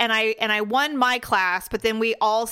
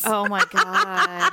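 laughing oh my God; 8 semitones higher, slower than usual. Real, not acted. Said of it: "laughs at the story".

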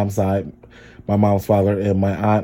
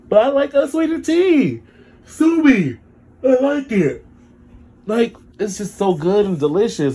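high-pitched defiance; 12 semitones higher than usual. Real, not acted. Defending his tea to the viewers, high playful squeal. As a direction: jump way higher for the sassy part.

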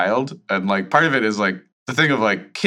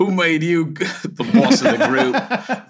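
hammed-up, higher-pitched Cockney accent breaking into laughter; 8 semitones higher than usual. Shown as a demonstration. Host obliges co-host's request, joke Cockney accent. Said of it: "do a Cockney accent anyway".

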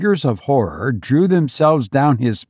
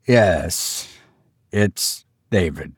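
low, toneless husband voice; monotone; 6 semitones lower, slower than usual. A narrator's reading. Husband confirming their son's death, in a monotone. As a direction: answer low with no expression. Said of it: "His voice was monotone".

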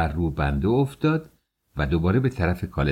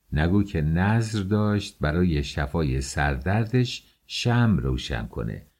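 ordinary delivery, nothing marked

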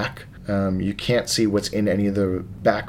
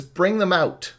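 commanding; 8 semitones higher than usual. Acted. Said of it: "And he says".

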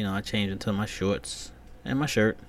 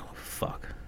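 horrified whisper; 11 dB quieter than usual. Retold or imitated. Discovering the stain.

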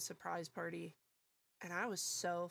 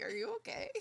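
high, put-on worried voice; concerned voice; 11 semitones higher, faster than usual. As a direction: ask gently with mock concern. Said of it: "He was like".

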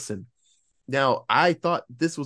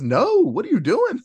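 a much higher, louder panicked protest; alarmed yell; 10 semitones higher, 5 dB louder than usual. Retold or imitated. Nicholson yelling at Joyce, higher panicked shout. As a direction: shout it like you're stopping a friend. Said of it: "And Nicholson says".

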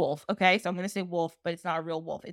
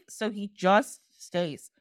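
ordinary talk

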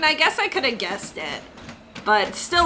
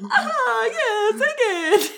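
much higher rat voice, copied; imitating Crispy Rat; 12 semitones higher, slower than usual. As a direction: echo the rat's line in its high voice.

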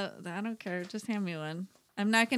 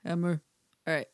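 ordinary talk, nothing marked

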